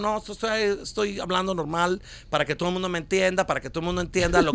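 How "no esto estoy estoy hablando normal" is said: softer, prim neutral Spanish imitation; mock neutral accent. Mocking people who fake a neutral accent, lightly imitated. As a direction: mimic someone forcing a neutral accent.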